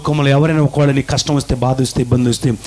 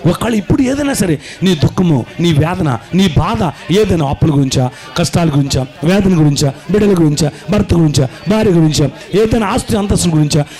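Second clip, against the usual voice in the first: preaching at full force; 5 semitones higher than usual. Acted. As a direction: urge them to weep and pray. Full force prayer exhortation. (తీవ్ర ఉద్వేగంతో ప్రార్థనకు పిలుపు)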